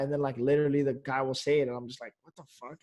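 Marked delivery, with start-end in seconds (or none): 2.2-2.8 very soft, stunned aside; hushed disbelief. Retold or imitated. Man recalling his buddy's uncanny fight call, hushed disbelief. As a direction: retell amazement under your breath. Retells stunned reaction to friend's prediction.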